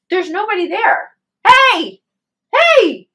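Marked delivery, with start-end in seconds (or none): 1.5-3.1 very high, loud shouts into the dark; screaming. Retold or imitated. Husband shouting into the empty moonlit road, screaming. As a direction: call out hard to an unseen helper. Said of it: "He's screaming".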